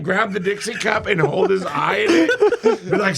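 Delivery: ordinary talk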